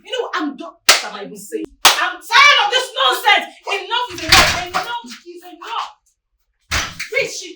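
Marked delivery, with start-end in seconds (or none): 1.8-4.7 loud, slow and emphatic fury; exasperated, raised-voice ultimatum; angry outburst. Acted. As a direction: shout that you have had enough. Acted quarrel, spouse at breaking point.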